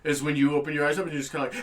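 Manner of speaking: ordinary talk, nothing marked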